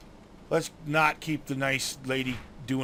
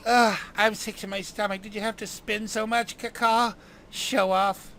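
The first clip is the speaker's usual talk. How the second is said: chirpy fairy; 5 semitones higher, 4 dB louder, slower than usual. Acted. Fairy queasy after the flight.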